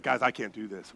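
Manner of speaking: low, soft mock-scared voice; imitated nervous plea. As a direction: quietly imitate a man losing his nerve. Imitates petrified claustrophobic man.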